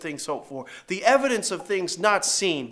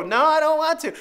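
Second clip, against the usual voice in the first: put-on higher, pleading kid refusal; higher child voice refusing dad; whiny little girl; 9 semitones higher, 6 dB louder than usual. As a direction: imitate a five-year-old digging in. Daughter refusing.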